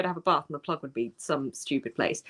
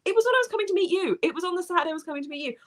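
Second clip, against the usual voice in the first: excited rush; 10 semitones higher, faster than usual. Real, not acted.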